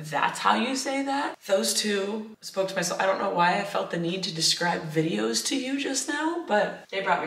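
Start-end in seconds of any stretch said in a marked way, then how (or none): 0.1-1.4 surprised. Retold or imitated. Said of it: "everyone was like".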